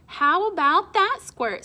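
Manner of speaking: loud, high teasing jab; teasing uncle. Acted. Spyro needling Nico at the table, loud teasing. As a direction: rib the kid loudly.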